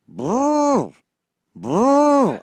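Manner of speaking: high mock phone notification "boo, boo"; imitated phone alert. Mimicking the phone chiming on the cohost's end, high. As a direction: sing out the text tone.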